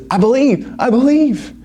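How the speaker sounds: high, desperate I believe; yelling back. Acted. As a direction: shout it up from the branch. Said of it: "he yells that back".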